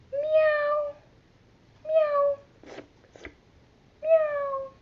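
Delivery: high mewing kitty calls, very high; high cat meows. Shown as a demonstration. Teacher doing the purple cat for the kids, high meows. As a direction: mew like a small cat.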